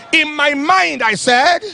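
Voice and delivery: ordinary talk, nothing marked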